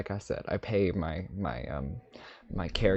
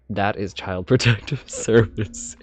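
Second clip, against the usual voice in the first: breaking into a laugh, louder; 8 dB louder than usual. Real, not acted. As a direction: crack up on the last word. Cracks up at own slip.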